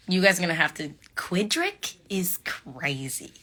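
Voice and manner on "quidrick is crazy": slow hushed Quidrick is crazy; whispered disbelief. Real, not acted. To the chat, slowly whispering about the ship name. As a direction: breathe it out, half-laughing disbelief.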